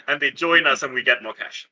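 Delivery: ordinary talk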